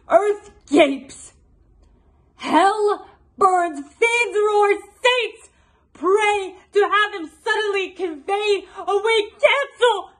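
high, full-throated shouted curse; Queen Margaret. Acted. Vengeful queen praying for his death, high impassioned shout. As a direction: cry it out to hell and heaven. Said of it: "a portion of Queen Margaret's monologue".

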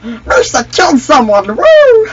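gasp, then a high loud whoop; thrilled cartoon character cheering a kill; excited Misa. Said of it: "I love doing that fucking voice".

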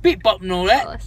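high-pitched fake Cockney; hammed-up bad Cockney; mock Cockney. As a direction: imitate a silly Cockney accent. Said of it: "Perfect accent".

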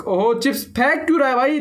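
usual speaking voice

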